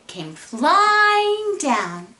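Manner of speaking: "flying" stretched high and loud; drawn-out narration. A narrator's reading. Narrator shows caps tumbling from tree, drawn-out. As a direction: swoop slowly up on "flying", then drop.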